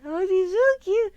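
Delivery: loud high gushing, slow. Real, not acted. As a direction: swoon over the puppy. Gushing over the puppy.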